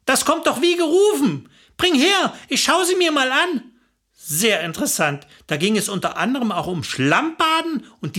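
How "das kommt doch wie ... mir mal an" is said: eager, higher-pitched exclamation; keen, delighted pounce. Acted. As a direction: jump at the offer, pitched up. Hildegard jumps at Renate's video.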